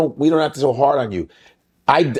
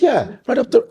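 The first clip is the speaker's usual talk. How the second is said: higher-pitched put-on British accent; 9 semitones higher than usual. Bluffing the guys about Bristol, put-on British accent. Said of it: "I just did a British accent".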